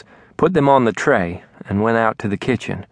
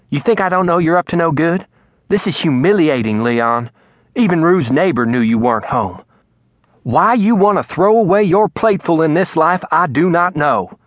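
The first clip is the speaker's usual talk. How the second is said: angry wife; 6 semitones higher than usual. Acted. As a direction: let her fury build at her husband. Mama berating Daddy.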